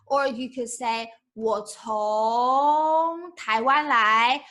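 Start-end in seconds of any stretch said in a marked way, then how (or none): 1.2-4.4 slow model phrase. Shown as a demonstration. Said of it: "teacher models the phrase slowly".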